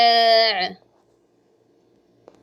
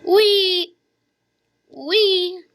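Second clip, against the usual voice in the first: high squeal; 8 semitones higher, 5 dB louder, much slower than usual. Real, not acted. Gaming reaction.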